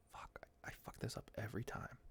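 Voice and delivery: whispered curse at himself; hushed grumble at his own slip; self-scolding whisper. Real, not acted. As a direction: whisper it under your breath, annoyed at yourself. Muttering at own mistake.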